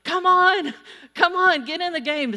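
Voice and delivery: loud, high sideline shout; coach yell. Acted. Coach firing up the congregation to play, loud coach yell. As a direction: urge them on like a coach. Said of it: "went to college to be a coach".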